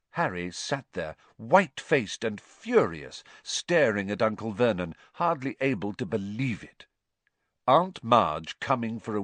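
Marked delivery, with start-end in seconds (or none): none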